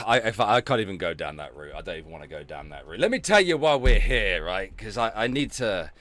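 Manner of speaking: usual speaking voice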